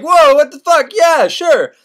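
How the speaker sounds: high, loud surprised reply; startled agreement. Retold or imitated. Couple answer Candide's dinner invitation, loudly surprised. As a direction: blurt it out high and loud, taken aback.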